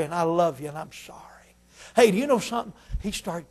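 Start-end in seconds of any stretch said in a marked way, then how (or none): 0.0-1.2 fading to a soft whispered apology; tender whisper. Retold or imitated. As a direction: let it fade to a whisper. Man leaving, tender apology.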